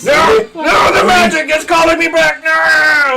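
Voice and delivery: high, loud cry of protest; desperate shout. Acted. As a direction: yell as you're pulled away. Minion dragged away by magic.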